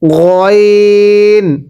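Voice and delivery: গইন said from the middle of the throat, slowly; mid-throat ghain. Shown as a demonstration. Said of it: "গলার মাঝখান থেকে উচ্চারণ হবে". (গলার মাঝখান থেকে গইন)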